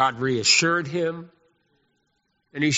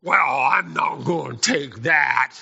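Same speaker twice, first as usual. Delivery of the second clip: outraged queen; 3 semitones higher, 6 dB louder, much slower than usual. Acted. Jezebel outraged at Elijah.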